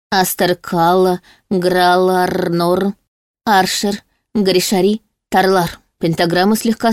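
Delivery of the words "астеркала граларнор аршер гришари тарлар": slow, drawn-out spell casting; confident incantation. A narrator's reading. Heroine activates the pentagram with a spell, confident incantation. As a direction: intone each magic word slowly and surely. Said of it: "уверенно произнесла заклинание активации".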